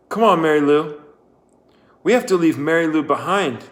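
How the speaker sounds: loud, high urgent call. A narrator's reading.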